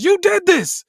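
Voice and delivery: high, very loud scream of blame; furious acted shout; accusing yell. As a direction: yell it at full force. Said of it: "covering his face and yells".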